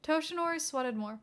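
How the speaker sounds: ordinary delivery, nothing marked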